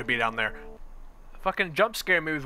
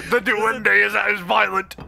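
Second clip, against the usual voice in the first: dramatic announcer; 3 semitones higher, 4 dB louder, slower than usual. Acted. Hammed-up dramatic line.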